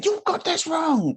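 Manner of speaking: high, slow, pointed correction; high slow know-it-all; mock blunt correction. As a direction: lecture them like they're wrong. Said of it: "you can't just go in and say".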